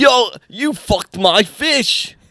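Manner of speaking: put-on poor British accent. Accusing someone over his fish, poor British accent. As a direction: attempt an outraged Brit accent, slowly. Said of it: "That's not very good".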